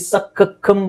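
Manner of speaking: slow ಸಖಖಮ್ with two separate k sounds; doubled c sounded. Shown as a demonstration. Teacher warns against this reading, both c's sounded. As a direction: break ಸಖಖಮ್ apart, sounding both k's. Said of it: "ಅಂತ ಹೇಳಬೇಡ".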